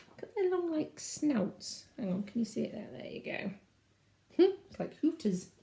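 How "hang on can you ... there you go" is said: plain reading voice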